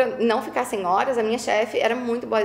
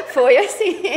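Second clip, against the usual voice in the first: high laughs around louder words; laughing at own joke; 9 semitones higher, 5 dB louder, slower than usual. Real, not acted. Laughing at her own joke about being dumped. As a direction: crack up at the punchline.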